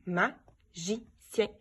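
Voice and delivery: stretched slowly syllable by syllable; drawn out so each syllable is heard; slow syllable-timed. Shown as a demonstration. Teacher slowly stretching a word for pupils to count syllables. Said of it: "Est-ce que je l'entends au début?".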